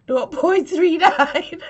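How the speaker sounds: real laughter with watering eyes; reading a number while fighting laughter; laughing tears. Real, not acted. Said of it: "my eyes are watering".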